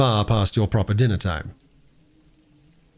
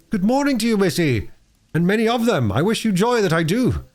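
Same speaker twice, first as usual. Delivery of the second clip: a jolly working man's greeting, pitched higher; cheerful blacksmith; 10 semitones higher than usual. Acted. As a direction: beam at her like a hearty blacksmith. Blacksmith greets Bobby heartily.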